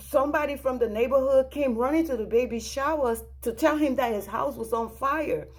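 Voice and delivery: high, loud retelling of an urgent caller; imitating an agitated witness explaining; frantic informant. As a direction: start on high nos, keep it urgent. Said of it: "She's screaming on the phone".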